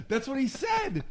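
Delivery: ordinary delivery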